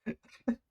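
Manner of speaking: sudden high laugh; burst of laughter. Real, not acted. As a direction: break out laughing. Reacting to burp clip.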